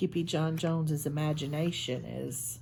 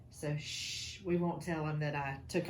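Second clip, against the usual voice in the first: playful hush; 4 semitones lower, 5 dB quieter than usual. Pretend. Sneaking into the boss's office.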